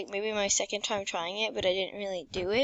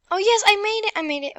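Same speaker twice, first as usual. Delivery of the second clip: louder relieved cheer; excited; 10 semitones higher, 10 dB louder, faster than usual. Real, not acted. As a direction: celebrate making it. Wins level.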